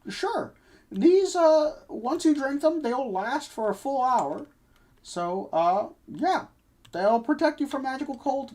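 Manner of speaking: chirpy shopkeeper pitching wares. Acted. GM voicing shopkeeper.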